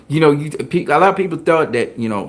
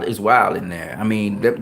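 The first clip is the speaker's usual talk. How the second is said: usual speaking voice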